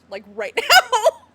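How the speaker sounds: high, loud, laughing and slowed. Real, not acted. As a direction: crack up on the last words. Laughs wanting to see reaction.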